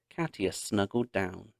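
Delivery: ordinary delivery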